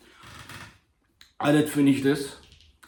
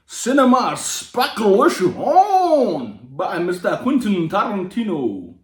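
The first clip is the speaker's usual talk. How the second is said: grand title announcement; 5 semitones higher, 3 dB louder, slower than usual. Pretend.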